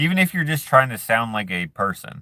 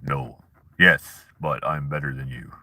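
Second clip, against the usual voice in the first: Prince; 8 semitones lower than usual. Acted. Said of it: "he sounded like this".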